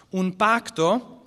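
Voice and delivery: usual speaking voice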